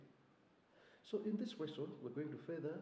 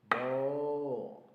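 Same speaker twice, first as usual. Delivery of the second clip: loud held moo; cow moo; 10 dB louder than usual. Shown as a demonstration. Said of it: "sound of a cow".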